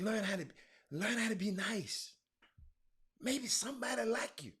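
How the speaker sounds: soft unvoiced whisper of a cheesy line; whispered come-on. Player whispering a pickup line to a woman, mock seductive whisper. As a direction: breathe it like a sleazy flirt.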